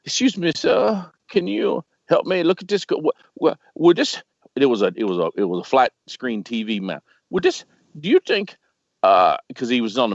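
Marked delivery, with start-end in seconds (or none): 0.0-4.2 put-on a man in a store asks for help in a rough Egyptian accent; imitated Egyptian accent. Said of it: "I can't do a good Egyptian accent". 7.3-8.5 put-on a man in a store asks for help in a rough Egyptian accent; imitated Egyptian accent. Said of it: "I can't do a good Egyptian accent".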